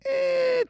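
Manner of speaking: high, held "eh" squeal; estimating noise. Real, not acted. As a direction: stretch out a high "eh" while guessing. Noise while estimating a number.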